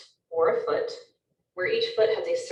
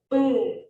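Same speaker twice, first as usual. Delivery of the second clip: louder, drawn-out cannon boom; 3 semitones higher, 5 dB louder than usual. Shown as a demonstration. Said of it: "the sound of the cannons".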